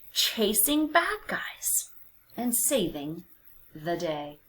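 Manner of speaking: usual speaking voice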